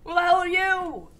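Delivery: high, loud terrified shouting; scared horror game streamer. Real, not acted. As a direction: yell in panic at the monster. Monster appears again.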